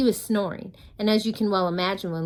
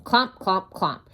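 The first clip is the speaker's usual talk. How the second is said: loud stomping; 7 dB louder, slower than usual. A narrator's reading. Heavy footsteps sound words.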